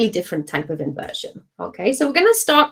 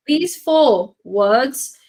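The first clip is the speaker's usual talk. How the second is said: slow each word for weight; deliberate, stretched emphasis; very slow, spelled out; 3 semitones higher, much slower than usual. Shown as a demonstration. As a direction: drag out "these four words". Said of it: "stressing key point".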